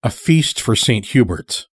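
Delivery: ordinary delivery, nothing marked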